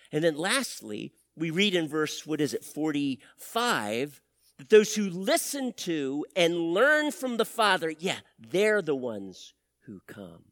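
usual speaking voice

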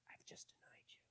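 barely breathed confession; ashamed whisper. Retold or imitated. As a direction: whisper it in shame. Ashamed Peter after the rooster.

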